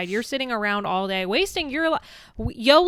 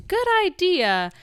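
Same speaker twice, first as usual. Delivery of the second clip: imitating Sam; 10 semitones higher, 4 dB louder, slower than usual. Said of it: "And then Sam says".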